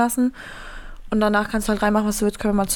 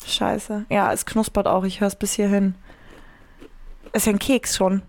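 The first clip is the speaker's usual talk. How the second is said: ordinary talk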